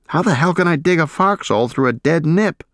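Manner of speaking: indignant protest. Acted. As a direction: protest it in disbelief. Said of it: "I protested".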